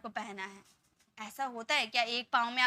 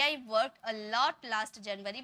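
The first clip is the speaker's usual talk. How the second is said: very slow, careful model sentence; 4 semitones lower, much slower than usual. Shown as a demonstration. Teacher repeats the correct sentence slowly. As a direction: lay out every word for the class. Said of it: "हम simply बोलेंगे".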